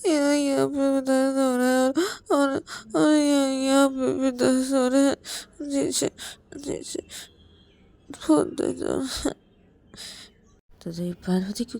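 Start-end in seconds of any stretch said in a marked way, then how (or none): 0.0-9.3 tearful. Acted. Payal crying, showing where it hurts.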